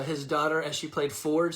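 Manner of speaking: ordinary talk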